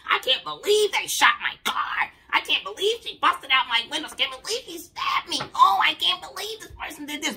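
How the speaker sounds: high whiny wronged voice, I can't believe; mock victim whine. Mocking people who want sympathy, whiny and high. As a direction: whine like someone fishing for sympathy. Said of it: "Then you want some sympathy".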